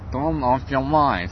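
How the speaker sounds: put-on slow, stern Finnish-accented English. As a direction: mimic a Finn's English, slowly. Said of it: "у них такой акцент гусары".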